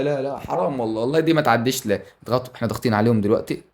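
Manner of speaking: ordinary talk, nothing marked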